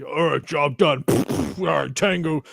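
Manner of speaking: lightly mocked action-hero killer; a hint of military tough guy, played for laughs; cold-hearted killer. Mocking what Joel is not, jokey cold-hearted killer. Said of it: "Joel is a cold-hearted killer".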